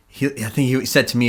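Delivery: ordinary talk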